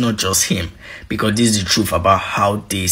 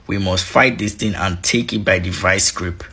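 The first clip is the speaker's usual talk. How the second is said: usual speaking voice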